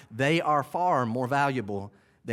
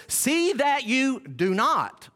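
higher and louder, very slow, "See" hammered; forceful verse; 9 semitones higher, 3 dB louder, much slower than usual. Shown as a demonstration. Pastor driving home the verse to the congregation, forceful and slow. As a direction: preach "See that you do not" with full weight on "See". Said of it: "Listen to it".

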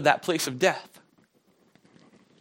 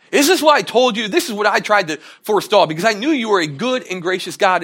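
loud, higher mock outrage; angry Jonah; 6 semitones higher, 7 dB louder than usual. Preacher mimics Jonah complaining loudly to God.

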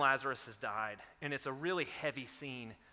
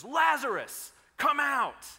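loud, high call into the tomb; Jesus calls Lazarus out of the tomb, shouting; commanding shout; 11 semitones higher, 10 dB louder, slower than usual. Acted. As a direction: bellow it like raising the dead.

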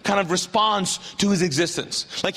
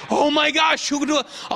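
higher-pitched acted thrill; bursting excitement; 7 semitones higher than usual. Healed woman who can't tell anyone, acted excitement. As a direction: gush as if you can barely hold it in. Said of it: "how do you communicate so much excitement".

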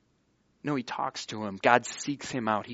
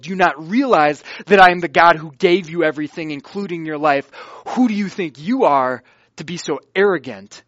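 angry rebuke; 2 semitones higher, 9 dB louder than usual. Acted. Preacher voicing God rebuking Cain, loud indignant rebuke. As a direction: thunder at him like an outraged God.

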